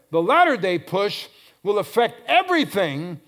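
loud, high pulpit cry; impassioned preaching. Real, not acted. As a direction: drive each phrase home to the congregation.

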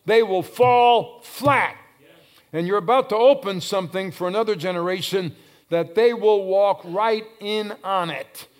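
loud, emphatic pulpit delivery; forceful preaching. Real, not acted. Preacher proclaiming the walls will fall flat, forceful preaching. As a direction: hammer the phrases out to the crowd.

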